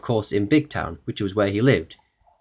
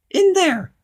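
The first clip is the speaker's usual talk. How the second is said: very high, loud goblin question; little goblin voice; 6 dB louder than usual. A narrator's reading. Goblin asking Mister Uppity for a hello, little high voice. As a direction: squeak it out like a tiny goblin. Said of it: "said the goblin".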